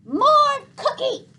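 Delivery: put-on high, loud, slow little-girl whine; toddler voice. A narrator's reading. Boots the toddler tugs on Gregor begging, high whiny toddler voice. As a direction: demand a cookie like a two-year-old.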